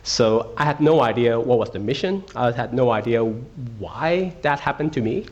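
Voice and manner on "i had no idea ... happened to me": ordinary talk, nothing marked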